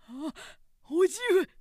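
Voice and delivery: high, loud anguished call; loud cry. A narrator's reading. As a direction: cry out to the dead uncle. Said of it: "すがりついて声限り呼んだ".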